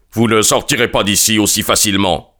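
ordinary delivery, nothing marked

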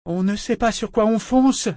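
high shout from below. A narrator's reading. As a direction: call up over the noise. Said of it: "lui cria Ambre d'en bas".

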